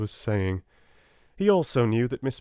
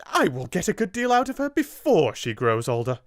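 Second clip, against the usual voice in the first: high, loud exclamation from the headmistress; exclaiming; 9 semitones higher, 5 dB louder than usual. Acted. Miss Minchin answers the lawyer with a loud exclamation. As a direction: cut in loud and high, greedy. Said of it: "exclaimed Miss Minchin".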